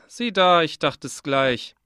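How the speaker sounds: plain reading voice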